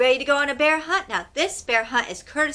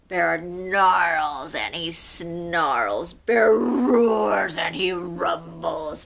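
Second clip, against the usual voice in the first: growly narration; 6 semitones lower, much slower than usual. A narrator's reading. Growling bear described dramatically.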